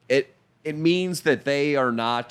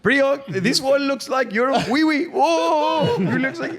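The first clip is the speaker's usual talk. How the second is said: much higher, mock Indian accent; Indian accent impression; 11 semitones higher, slower than usual. Coworker joking about hot dogs: squeaky mock Indian accent.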